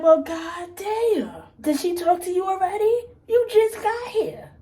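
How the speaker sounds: high, slow, drawn-out disbelief; exasperated disbelief. Retold or imitated. To Thing Two about the new girl, high slow exasperation. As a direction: stretch it out like you can't believe she already talked.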